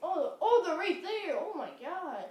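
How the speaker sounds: high, loud alarmed call; sudden alarm at spotted enemies; excited alarm. Real, not acted.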